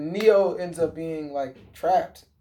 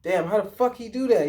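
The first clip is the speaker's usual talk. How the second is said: higher, excited disbelieving question; amazed; 6 semitones higher than usual. Retold or imitated. Witness after the excitement, higher amazed question. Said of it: "you would be like".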